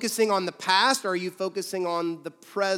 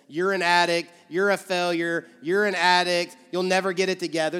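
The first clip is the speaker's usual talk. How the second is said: louder accusing voice; louder, pressing devil's accusation; loud condemnation; 6 dB louder than usual. Shown as a demonstration. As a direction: hammer the labels loudly. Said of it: "You hear the difference?".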